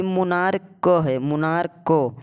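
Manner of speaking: "मोनार्क" with the final "क" sounded separately; stressed k sound. Shown as a demonstration. Teacher showing which sound comes, "मोनार्क" with detached k. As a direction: release a distinct k after "मोनार्क". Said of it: "क आ रहा है कि च आ रहा है?".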